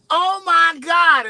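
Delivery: high, loud, slow squeal of a starstruck fan; man in grocery line squealing at comedian; excited fan. Retold or imitated. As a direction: gush like a fan spotting a celebrity. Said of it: "He was like".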